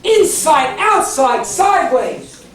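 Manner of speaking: forceful, high sermon delivery; rousing pulpit cry; impassioned preaching. Real, not acted. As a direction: preach it loud, hammering each short phrase.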